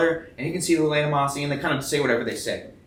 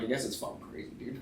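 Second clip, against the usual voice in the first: whispered shrug; 13 dB quieter, faster than usual. Retold or imitated. Retold muttered reply to wife.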